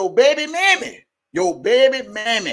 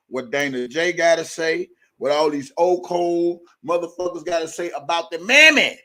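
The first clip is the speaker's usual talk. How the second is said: ordinary delivery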